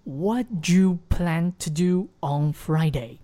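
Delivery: slow and linked throughout; slow linked reading. Shown as a demonstration. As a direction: keep it connected while slow. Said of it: "我们再来慢速的连读". (慢速连读)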